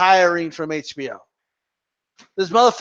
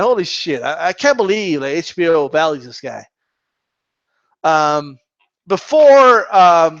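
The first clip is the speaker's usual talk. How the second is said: ordinary talk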